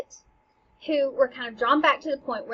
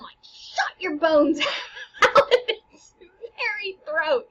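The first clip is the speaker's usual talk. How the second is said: laughs and squeals through it; laughing; 4 semitones higher, 5 dB louder than usual. Real, not acted. Teacher cracking up explaining the ghost's bones. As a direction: crack up at the image. Describing the ghost's violent release.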